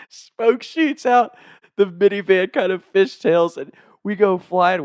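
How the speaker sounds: high, slow, laughing excitement; excited. Real, not acted. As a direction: tell it with wild excitement. Excited peak of the story.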